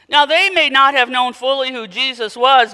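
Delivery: ordinary delivery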